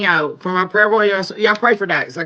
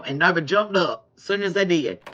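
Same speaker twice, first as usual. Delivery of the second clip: ordinary delivery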